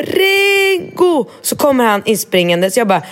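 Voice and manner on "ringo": mother yelling for her son; calling out. Retold or imitated. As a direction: call the boy from another room.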